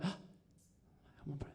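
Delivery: barely voiced mutter to oneself; hushed whisper. Man in bed listening for a scream, tense whisper. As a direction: whisper it under held breath.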